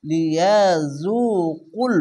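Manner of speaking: লিয়াজুকুল drawn out, with a heavy কুল; slow recitation. Shown as a demonstration. Teacher reciting লিয়াজুকুল with heavy কুল. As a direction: recite লিয়াজুকুল slowly, giving কুল weight. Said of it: "এটি মোটা অক্ষর কুল".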